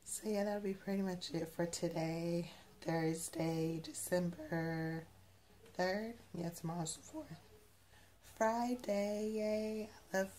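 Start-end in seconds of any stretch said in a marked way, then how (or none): none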